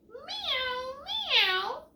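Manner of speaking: high mewing meow; playful high kitty meow; cat meow. Acted. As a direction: mew like a little cat. Imitates cat in story.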